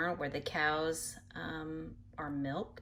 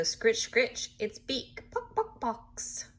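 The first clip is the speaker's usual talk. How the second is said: chicken sound effects; 6 semitones higher, slower than usual. Pretend.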